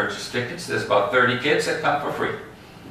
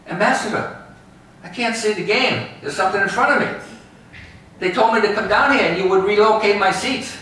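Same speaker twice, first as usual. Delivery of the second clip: whiny fan; 9 semitones higher than usual. Retold or imitated. Fan asks the ambassador for new seats, high pleading voice. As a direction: plead like a fan who can't see the game. Tour guide voicing a complaining fan.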